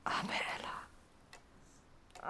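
breathy whispered pity. Acted. Hilde whispering pity for Ella after she leaves.

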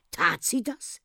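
mildly dismayed question; saddened. A narrator's reading. Captain hearing of the woman's misdeed, mildly saddened. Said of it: "fragte der Kapitän betrübt".

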